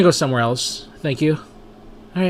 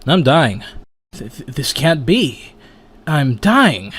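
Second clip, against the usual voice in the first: usual speaking voice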